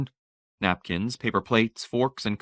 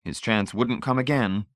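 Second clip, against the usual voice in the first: usual speaking voice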